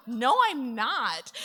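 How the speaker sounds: loud, drawn-out mock defiance; defiant retort. Wife contradicting her husband's warning, defiant. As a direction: dig in loudly, stretching the denial.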